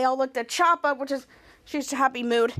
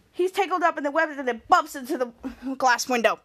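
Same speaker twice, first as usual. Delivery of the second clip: ordinary delivery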